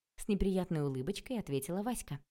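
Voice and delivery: usual speaking voice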